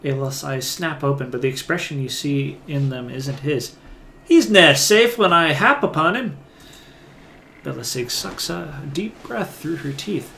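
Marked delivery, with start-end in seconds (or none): 4.2-6.4 put-on loud, higher Scots-flavoured accent; Isilmir's accent. Awakened soul interrupts through Aloth, loud Scots-style accent. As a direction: declare it in a forceful, raised Scots-style brogue. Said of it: "Isilmir's accent".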